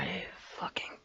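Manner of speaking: whispered curse after a fright; shaken whisper. Real, not acted. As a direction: hiss the curse under your breath. Cursing under breath after scare.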